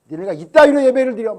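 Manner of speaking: hammed-up rage at a lazy congregation; mock angry scolding. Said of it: "막 이러면서".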